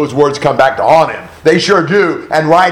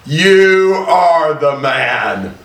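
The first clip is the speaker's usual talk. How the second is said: drawn out slowly, each word weighed; very slow dramatic delivery; much slower than usual. Shown as a demonstration. The verdict spelled out slowly for the class. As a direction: Bible teacher letting the prophet's accusation land, slow and dramatic. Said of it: "most dramatic sentence in the Old Testament".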